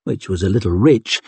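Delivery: ordinary delivery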